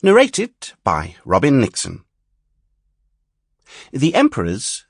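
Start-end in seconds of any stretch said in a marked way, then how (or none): none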